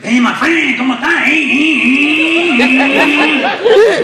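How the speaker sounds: high, loud greeting revving like an engine; motorcycle-like excitement. Retold or imitated. Excited friend greeting the comedian, revving like a motorcycle. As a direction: get so excited the words turn into a motorcycle. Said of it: "he actually sounds like a motorcycle".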